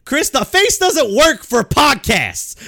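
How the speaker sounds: high, loud, slow teasing yell; calling him out, cracking up; laughing shout. Real, not acted. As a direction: tease him through a laugh. Teasing co-host's face.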